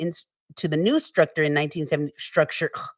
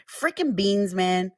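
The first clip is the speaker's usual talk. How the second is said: normal talking voice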